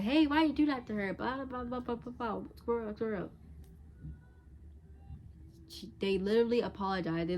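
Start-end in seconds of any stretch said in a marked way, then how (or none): 0.0-1.1 a pitched-up, louder mock challenge; mock confrontation. A meddling friend picking a fight, mock indignant. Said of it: "your friend is like". 1.1-3.3 mocking babble. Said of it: "your friend is like".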